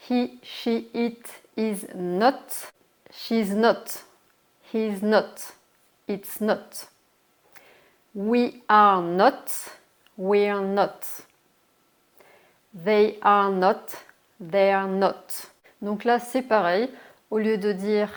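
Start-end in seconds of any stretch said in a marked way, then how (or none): 0.1-2.7 slow demo. Shown as a demonstration. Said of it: "teacher modelling English forms". 8.1-9.5 slow model reading. Shown as a demonstration. Teacher showing the full negative form, slow and loud. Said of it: "teacher modelling English forms". 12.8-14.0 slow model reading. Shown as a demonstration. Teacher showing the full negative form, slow and loud. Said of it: "teacher modelling English forms".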